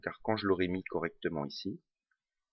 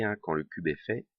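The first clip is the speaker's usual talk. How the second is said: usual speaking voice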